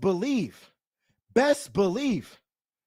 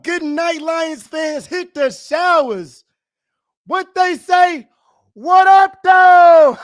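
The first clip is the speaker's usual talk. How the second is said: loud, high victory yell; triumphant shout; 7 semitones higher, 8 dB louder, slower than usual. Real, not acted. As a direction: roar it at the Lions fans. Celebrating, taunting rival fans.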